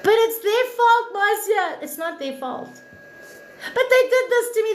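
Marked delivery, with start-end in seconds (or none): 0.0-1.8 higher, louder whiny mock protest; whiny objector. To viewers, mimicking someone who blames others. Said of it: "imagined viewer objection". 3.7-4.7 higher, louder whiny mock protest; whiny objector. To viewers, mimicking someone who blames others. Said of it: "imagined viewer objection".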